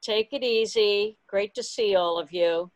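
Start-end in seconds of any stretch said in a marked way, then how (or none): none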